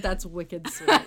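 repeats wicked sweet laughing, in a light Boston twang; laughing mock of her own accent; laughing Boston quote. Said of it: "I've never said".

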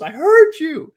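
high, loud irritated snap; snapping dad. Retold or imitated. Dad in headphones snapping back at his yelling daughter, high and loud. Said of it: "snaps back".

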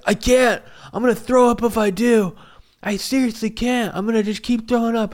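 high, strained mock yell; distressed protest. Sick man refusing a mask, high mock wail. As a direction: imitate a sick man wailing back loudly.

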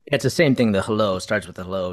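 ordinary talk

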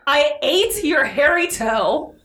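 mild storybook shout of terror; mock shout. A narrator's reading. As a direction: declare it slowly in mock panic. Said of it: "and shouted".

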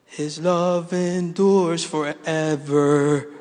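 very slow, stretched-out imitation of his wife; drawn-out answer. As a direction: drag each word out to the end. Wife's answer, acted out slowly.

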